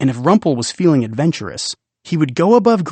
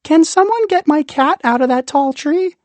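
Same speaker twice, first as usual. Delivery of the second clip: put-on very high, louder little kid calling for help; child's voice; 13 semitones higher, 4 dB louder than usual. Imagined child above ground asking for help with cat, piping high. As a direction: pipe up like a small child.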